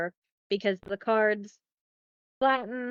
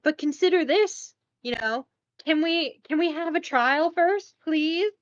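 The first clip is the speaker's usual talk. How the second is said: higher, pleading little king voice; higher timid king begging politely; 6 semitones higher than usual. Retold or imitated. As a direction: coax her like a timid husband.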